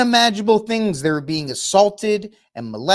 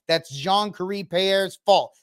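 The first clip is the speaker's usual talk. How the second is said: ordinary delivery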